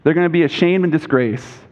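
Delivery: usual speaking voice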